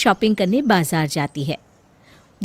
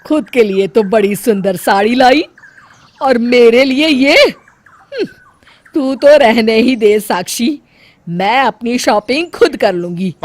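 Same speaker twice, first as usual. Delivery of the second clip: loud, huffy mother-in-law; flaring up; 2 semitones higher, 8 dB louder than usual. Acted. Mother-in-law flares up at the bahu over the saris. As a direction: bristle with offended anger. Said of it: "तो वो भड़क जाती है".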